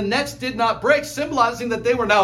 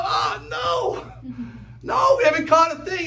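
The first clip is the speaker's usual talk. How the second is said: calling back; 9 semitones higher, slower than usual. Retold or imitated. Disciples shout back to Jesus, disappointed. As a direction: yell it back from the boat. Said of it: "They go".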